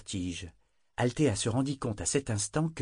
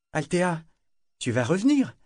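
put-on higher, pleading young voice; anxious ship's voice; 8 semitones higher, 4 dB louder than usual. A narrator's reading. The ship asking Althea if she will return, higher and anxious. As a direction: ask her with worried hope.

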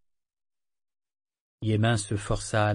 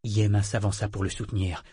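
plain reading voice

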